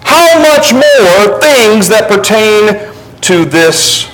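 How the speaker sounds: rising emphatic sermon reading; preaching scripture reading. Acted. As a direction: declaim the verses like Paul scolding Corinth. Reads Paul's rebuke aloud.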